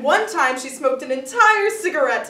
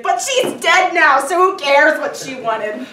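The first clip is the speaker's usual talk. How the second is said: callous outburst; 3 semitones higher, 9 dB louder than usual. Acted. Son at his mother's funeral, callously loud. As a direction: blurt it out brashly, voice jumping up. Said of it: "Oh, that sounded bad".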